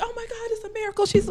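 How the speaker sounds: high, fast hammed-up miracle gasp; mock amazed onlooker. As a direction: gush like a stunned bystander. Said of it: "I'm like".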